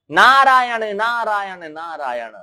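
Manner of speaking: Narad's arrival call. Acted. Wandering sage announcing himself at the family's door, chanted call. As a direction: call out the holy name in a descending chant.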